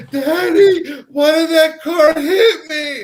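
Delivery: put-on high, loud, drawn-out child's wail; crying little girl. Little girl to her daddy after the crash, mock crying. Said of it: "I hear this little girl crying".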